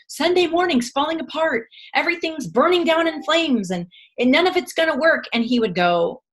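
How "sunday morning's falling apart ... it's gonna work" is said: higher, hurried panicky report; mock panic. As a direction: blurt out the disaster in mock alarm. Staffer panicking to the pastor.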